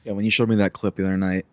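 normal talking voice

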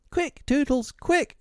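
mild fairy scream, pitched very high; Tinker Bell screaming. A narrator's reading. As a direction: urge him on in a tiny shrill voice. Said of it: "she screamed".

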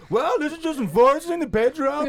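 high, loud imitation of a woman comic; mock female comic. Retelling a female comic's joke, mocking high voice.

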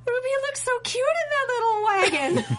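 high, gushing, half laughing; gushing fan. Retold or imitated. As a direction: coo over it like a smitten fan. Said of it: "that's when you start going".

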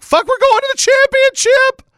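very high, loud reenacted celebration; elated shout. Fan after a comeback win, elated shout. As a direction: holler it like a delirious fan.

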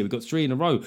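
ordinary talk

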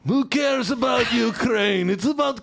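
high, full-volume ranting announcer; shouted mock promo. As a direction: shout it like an over-the-top propaganda ad, pitch way up. Performs mock Russian promo.

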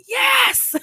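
sudden high, loud burst of joy; overjoyed cry of yes; excited yell. Retold or imitated. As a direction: shout it like you just got great news. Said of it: "he's so excited".

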